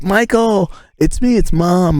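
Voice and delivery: high, slow, sing-song mom voice; high cheery mom call. Retold or imitated. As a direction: call your son cheerily across the classroom. Mom voiced entering his class.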